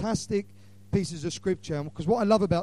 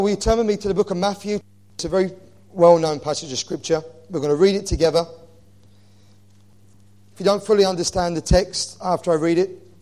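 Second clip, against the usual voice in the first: ordinary talk, nothing marked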